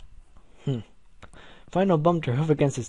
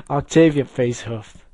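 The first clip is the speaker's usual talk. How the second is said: plain reading voice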